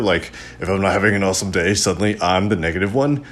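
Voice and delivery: ordinary talk, nothing marked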